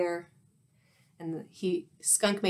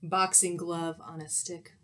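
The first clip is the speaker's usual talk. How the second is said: plain reading voice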